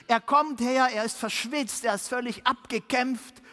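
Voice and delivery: normal talking voice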